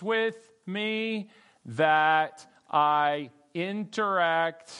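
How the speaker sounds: very slow, each word weighed out; slow, deliberate restatement. Shown as a demonstration. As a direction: spell the meaning out slowly for the class. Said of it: "He's saying that these are".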